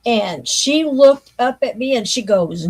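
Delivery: ordinary talk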